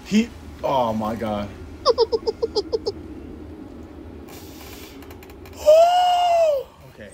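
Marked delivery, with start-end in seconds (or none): 1.5-6.9 long high shrieking laugh; real helpless high laugh. Real, not acted. Laughs after getting jumped.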